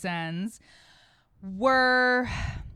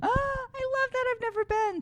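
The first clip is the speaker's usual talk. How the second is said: excited. Real, not acted. Delighted reaction.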